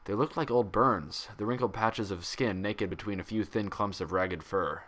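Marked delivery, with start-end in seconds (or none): none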